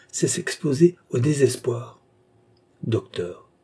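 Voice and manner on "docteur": low, soft murmur. A narrator's reading. Anxious patient to her doctor, murmured. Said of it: "murmura André".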